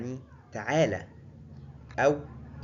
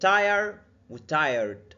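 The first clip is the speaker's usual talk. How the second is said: slow, each word "tire" and "tired" drawn out; contrast tire and tired; 3 semitones higher, 6 dB louder, much slower than usual. Shown as a demonstration. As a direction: set tire against tired. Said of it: "ولازم نفرق ما بين". (مقارنة بطيئة بين tire و tired)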